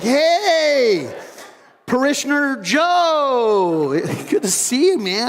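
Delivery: high, loud, stretched-out fake friendliness; covering awkwardness with a big hello; forced cheerful greeting. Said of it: "And I'm like".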